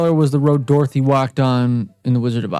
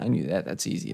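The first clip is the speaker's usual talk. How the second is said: much softer, voiced, half to himself; quiet aside; 6 semitones lower, 10 dB quieter than usual. Real, not acted. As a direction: say it under your breath. Muttering to himself after a right answer.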